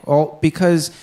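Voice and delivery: ordinary delivery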